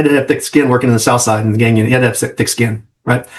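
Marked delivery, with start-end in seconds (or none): none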